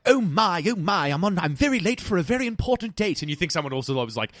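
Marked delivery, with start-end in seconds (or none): 0.1-3.2 high, fluttery panicked rabbit voice; a fussy rabbit fretting over being late, pitched up high. Retold or imitated. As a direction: squeak it out like a rabbit running late. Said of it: "the rabbit was like".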